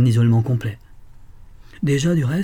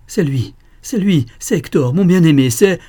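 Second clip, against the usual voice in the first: high, loud and slow cry of joy; ecstatic recognition; 6 semitones higher, 6 dB louder than usual. A narrator's reading. A woman at the window recognizing her beloved, crying out loud and high. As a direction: cry out as memory floods back.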